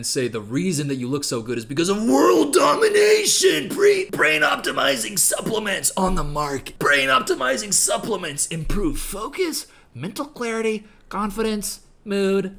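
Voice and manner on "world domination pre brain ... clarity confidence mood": high, booming, drawn-out supplement hype; mock hype pitch. Spoofing an influencer's supplement advert, bombastic hype. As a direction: blast it out like an over-the-top advert.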